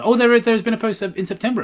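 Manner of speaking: sudden surprised discovery. Real, not acted. Finds an unexpected post.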